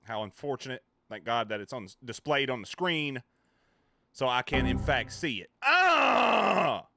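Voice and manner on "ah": sudden high pained scream; pained yelp. Real, not acted. Streamer yelps at a painful sound alert, loud scream. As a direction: yelp as the sound hits hard. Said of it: "That fucking hurts".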